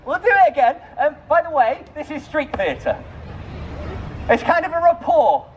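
high, projected street-show patter; street performer. Real, not acted. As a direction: pitch it high to reach the crowd. Street performer working a crowd.

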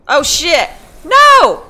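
high, loud panicked cry; panicked yelp. Real, not acted. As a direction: shriek in sudden alarm. Sudden danger in game.

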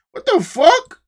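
usual speaking voice

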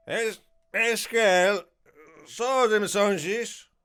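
put-on loud, high slurred drunk. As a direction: drunk calling to friends. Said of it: "jak udaje pijanego Geralta".

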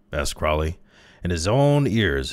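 plain reading voice

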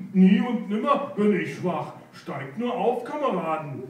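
offended hare shouting that he is not weak; indignant cry. Acted. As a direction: protest hotly, then wave them aboard. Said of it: "rief Rudi".

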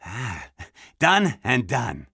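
low, slow and pleased; slow, deep sigh of acceptance; satisfied agreement. Acted. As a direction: savour the deal, dropping very low. Murph gladly accepts the bribe.

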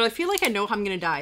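usual speaking voice

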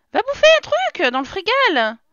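high, loud exasperated order; loud, high-pitched nagging; bossy order. Pretend. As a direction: order the character off, voice pitched right up. Ordering game character to eat.

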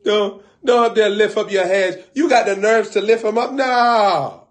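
high, loud exasperated scolding; loud mock rant. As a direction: scold them at full volume. Loud ranting at people pointing.

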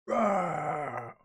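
lion roar. Acted. The lion attacks.

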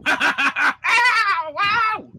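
laughing high exclamation. Real, not acted. Laughing reaction to the letters.

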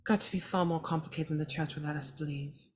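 ordinary delivery

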